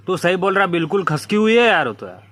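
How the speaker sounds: ordinary talk, nothing marked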